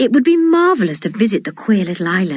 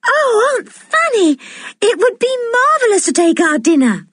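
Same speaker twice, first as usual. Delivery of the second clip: put-on high, thrilled girl's voice; eager cry. A narrator's reading. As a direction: cry out with delight, much higher. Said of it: "cried Anne".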